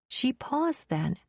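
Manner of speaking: ordinary delivery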